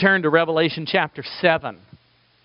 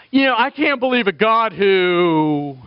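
mock objector; 4 semitones higher, 3 dB louder than usual. Imitating common objection to God.

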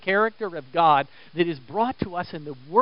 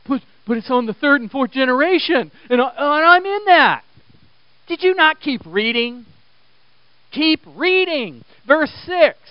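raised, insistent preacher's challenge; exasperated pulpit urging; full-force preaching; 8 semitones higher, 8 dB louder than usual. Real, not acted. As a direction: drive it home to the congregation, high and loud.

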